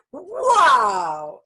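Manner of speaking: loud, showy wow; be the peacock showing off its feathers; proud peacock wow. Pretend. Peacock answering her in nature with a proud wow.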